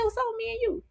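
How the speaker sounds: high, soft teasing quote; quoting Lestat. Retold or imitated. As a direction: lightly voice Lestat, high and hushed. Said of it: "he said".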